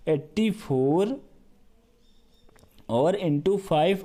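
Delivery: drawn out word by word; slow dictation. Shown as a demonstration. Maths teacher slowly spelling out eighty-four into five.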